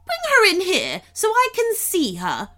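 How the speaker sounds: high, loud imperious princess; screeching command. A narrator's reading. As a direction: order it shrilly from the throne room. Screeching princess gives an order.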